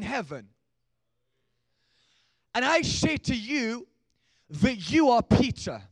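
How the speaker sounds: slow, loud, high preached reading; preaching scripture. Acted. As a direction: proclaim each word slowly.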